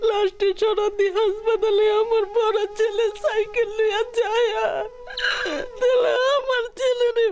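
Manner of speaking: real grieving father sobbing through words; choked-up sobbing, voice breaking; snotty crying. Real, not acted. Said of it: "আবেগ আপ্লুত হয়ে পড়েন".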